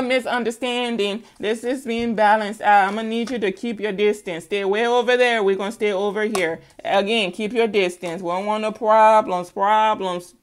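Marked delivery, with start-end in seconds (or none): none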